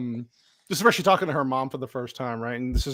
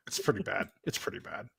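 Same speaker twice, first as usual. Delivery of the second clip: breathy aside, under the breath; whispered; 3 semitones lower, 11 dB quieter than usual. Real, not acted. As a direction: murmur it to yourself. Second take whispered.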